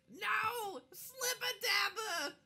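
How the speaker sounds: high, loud, slow, half-laughing goblin wail; wailing goblin grief. Acted. Goblin wailing to the party over its slain friend. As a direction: cry out over a dead friend like a squeaky goblin.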